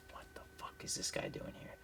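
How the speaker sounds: hushed whispered aside. Retold or imitated. Road Dogg's silent stare, whispered by the teller. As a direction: whisper it under your breath.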